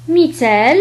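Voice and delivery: normal talking voice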